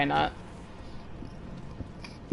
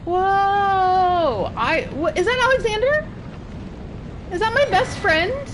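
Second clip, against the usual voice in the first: high-pitched, amazed questioning; high, astonished and thrilled; delighted surprise; 9 semitones higher than usual. Real, not acted. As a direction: spot a dear friend, voice leaping up. Spots a beloved character.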